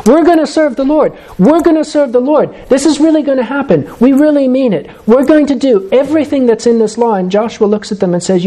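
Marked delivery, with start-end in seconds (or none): 0.1-7.1 eager crowd crying out. Retold or imitated. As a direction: cry it out like a people sure they'll obey. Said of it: "the people cry out all with one voice".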